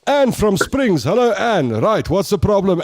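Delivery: a hint of a chatty caller; imitating mother on radio. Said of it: "She'd say".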